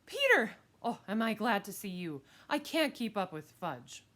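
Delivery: loud high call easing into relieved talk; relieved mother. Acted. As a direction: greet your son, glad of rescue. Harried mother spots her son.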